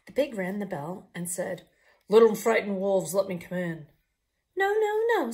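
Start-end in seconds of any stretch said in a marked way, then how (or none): 2.1-3.9 loud, gruff demand at the door; bully your way in; the pig calling loudly at the door; big bad pig voice. 4.6-5.3 much higher squeaky refusal; little wolves' voice. Acted.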